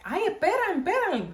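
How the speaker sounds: high-pitched grandma begging them to wait; imitated grandma. Older grandma asking them to wait.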